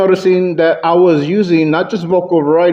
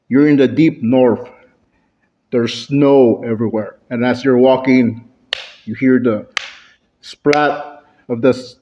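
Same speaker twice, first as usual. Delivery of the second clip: game master's scene setting; 4 semitones lower than usual. Shown as a demonstration. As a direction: draw the players in with low, measured storytelling. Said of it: "I'd introduce the scene".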